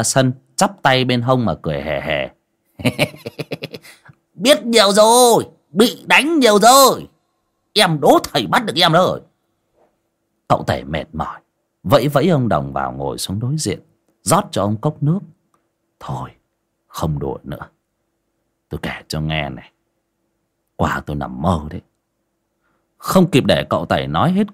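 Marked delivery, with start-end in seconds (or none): 2.8-3.8 high teasing hehe laugh; cheeky cackle. A narrator's reading. Old Đồng teasing his master from the yard, high cackle. 4.3-9.2 cheeky taunt. A narrator's reading. Đồng taunting his master from the yard, high and sing-song. Đồng teasing after escaping swat. 16.0-21.8 softer, low confiding tone; hushed confiding. A narrator's reading. Master Tẩy quietly confiding his dream to Đồng.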